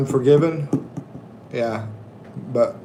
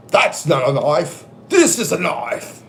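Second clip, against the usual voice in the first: a hint of Australian accent. Crocodile Dundee impression.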